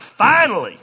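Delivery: relieved shout. Retold or imitated. Relief as the checkout ends.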